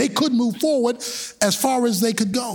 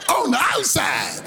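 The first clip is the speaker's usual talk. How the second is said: slow, higher shouted preaching; preaching at full force; 12 semitones higher, much slower than usual. Acted. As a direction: drive it home, slowly. Preacher building the sermon.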